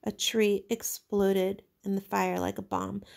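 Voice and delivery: plain reading voice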